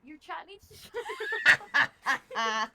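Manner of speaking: loud, slow words through laughter; laughing shout. Real, not acted. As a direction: blurt it out while cracking up. Embarrassed by chat's teasing.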